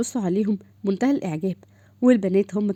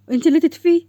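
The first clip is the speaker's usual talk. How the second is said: higher, louder insistence; insistent retort; 6 semitones higher, 6 dB louder than usual. Acted. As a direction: fire back, you do it. Bickering over the light.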